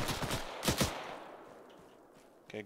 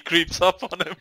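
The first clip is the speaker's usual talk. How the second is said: normal talking voice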